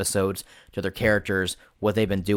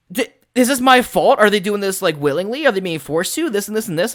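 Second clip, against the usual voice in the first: high, loud panicked questions; frantic questions; 11 semitones higher, 5 dB louder than usual. Retold or imitated. Player questioning the game master, frantic and high.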